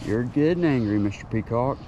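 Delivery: normal talking voice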